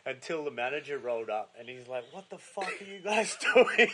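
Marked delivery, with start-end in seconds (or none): 2.1-3.9 furious boss yelling, half laughing; laughing angry shout. Retold or imitated. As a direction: yell it, laughing through it. Manager finds them drunk, shouting.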